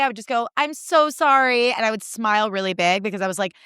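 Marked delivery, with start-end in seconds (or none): 0.6-1.7 slow, louder, over-sweet apology; fake sorry. Waitress faking an apology to a difficult table, slow and overdone. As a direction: apologize without meaning it. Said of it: "if I wasn't feeling that that day".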